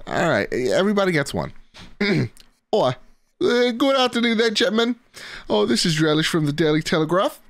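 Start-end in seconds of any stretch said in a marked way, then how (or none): 2.0-7.4 hammed-up British accent, pitched much higher; a listener's letter read as a British gent. Podcast host greeting listeners at a viewer's request, hammed-up British accent. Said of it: "he wants me to talk in a British accent".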